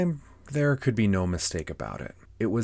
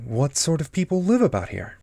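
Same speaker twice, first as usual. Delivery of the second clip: higher, louder questioning voice; curious Alice; 9 semitones higher, 7 dB louder than usual. Acted. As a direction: ask eagerly as a storybook girl. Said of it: "she tried another question".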